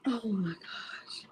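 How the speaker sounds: slow, hushed disbelief; overwhelmed whisper. Real, not acted. Taking in a friend's good news, whispering. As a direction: whisper it to yourself.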